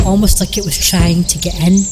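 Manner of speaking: ordinary delivery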